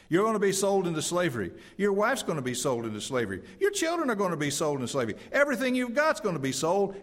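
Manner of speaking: full-force preacher; fervent preaching. Acted. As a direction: hammer the warning home.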